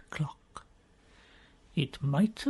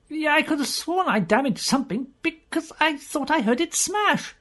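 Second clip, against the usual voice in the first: higher, worried rush; a higher voice half alarmed at the damage; higher voice fearing he smashed something inside; Pew's voice; 11 semitones higher than usual. Acted. Pew fears he broke something.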